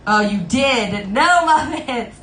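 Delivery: slow, stretched cry of mock dismay; playful dismay. Real, not acted. Streamer protesting a viewer's request for Muppets, playful dismay.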